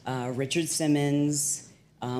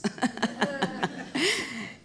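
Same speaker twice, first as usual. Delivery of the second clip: high, real laugh; 9 semitones higher, 4 dB quieter than usual. Real, not acted. Speaker revealing own fakeness to audience, amused laughter. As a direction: laugh at your own confession.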